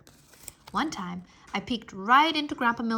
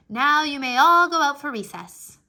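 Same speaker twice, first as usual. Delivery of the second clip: pretend teacher; 5 semitones higher, 10 dB louder than usual. Pretend teacher dismisses class.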